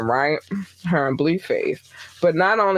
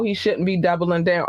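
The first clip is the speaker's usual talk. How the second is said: usual speaking voice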